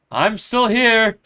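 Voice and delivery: slow, loud and high call. Acted. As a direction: call out into the dark. Calling out in the darkness.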